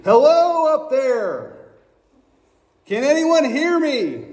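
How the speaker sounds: high, loud call from far below; a man dangling from a branch shouting up; scream for help. A narrator's reading. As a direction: yell up the cliff to anyone listening. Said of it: "So he started to scream".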